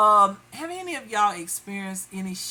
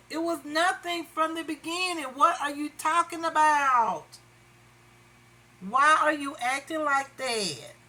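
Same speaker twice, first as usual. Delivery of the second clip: exasperated questioning; 6 semitones higher than usual. Retold or imitated. Exasperated questions to the absent pursuer.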